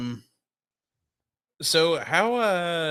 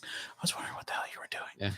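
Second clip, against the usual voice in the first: whispering; 4 semitones lower, 13 dB quieter, much faster than usual. Real, not acted. Whispered aside.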